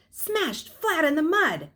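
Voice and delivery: plain reading voice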